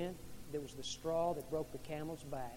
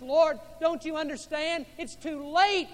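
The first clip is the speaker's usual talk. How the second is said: loud, high pleading cry; pleading protest; 11 semitones higher, 12 dB louder than usual. Acted. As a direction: plead with him desperately. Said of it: "She's pleading".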